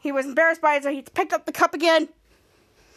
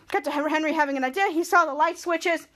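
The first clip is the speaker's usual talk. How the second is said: usual speaking voice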